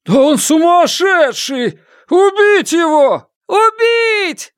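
very high mob scream; yelling. A narrator's reading. Someone in the crowd demanding a killing, yelling. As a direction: howl for blood, far above normal pitch. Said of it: "завопил кто-то в толпе".

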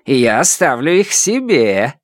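mildly gloating, lilting tone; smug, swooping taunt; sarcastic smile. A narrator's reading. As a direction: rub it in with a caustic smile. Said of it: "сказал он с язвительной улыбкой".

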